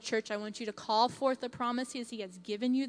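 normal talking voice

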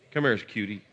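ordinary talk

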